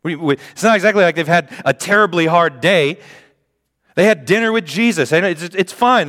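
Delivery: high, fast, indignant; incredulous rant. Acted. Preacher rants at the sleeping disciples, high and indignant.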